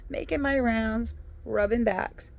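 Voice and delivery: usual speaking voice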